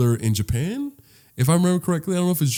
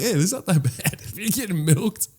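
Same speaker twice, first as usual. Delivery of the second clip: cow voice; 6 semitones higher, 6 dB quieter than usual. Acted. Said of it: "The bit, the bit was like".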